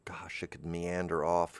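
soft unvoiced aside; whisper. Real, not acted. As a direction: whisper it to yourself.

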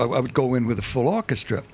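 usual speaking voice

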